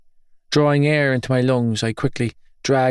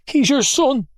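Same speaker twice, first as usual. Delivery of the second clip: pleading mother; 14 semitones higher than usual. Acted.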